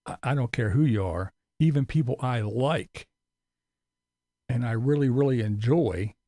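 ordinary talk

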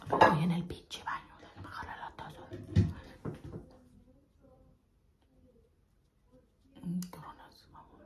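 hushed whisper. Real, not acted. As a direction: hide in the bathroom, whisper. Hiding in the bathroom.

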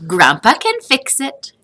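put-on a boy sure grandpa can help; child Joseph. A narrator's reading. Joseph answers his mother hopefully.

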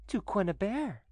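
high-pitched, triumphant confirmation. A narrator's reading. Widow confirming her bridegroom to the stunned cousin, triumphant. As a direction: answer his stammer with smooth triumph. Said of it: "in a tone of triumph".

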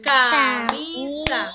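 loud, drawn-out syllable demo of "Camisa". Shown as a demonstration. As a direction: chant out Camisa beat by beat for the class. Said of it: "Tenemos como ejemplo camisa".

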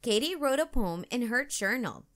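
plain reading voice

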